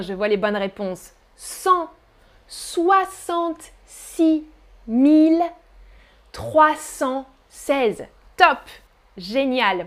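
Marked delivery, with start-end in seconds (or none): 1.4-8.1 each part drawn out slowly; very slow dictation. Shown as a demonstration. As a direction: stretch every chunk slowly. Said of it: "repeating number for class". (dictée très lente)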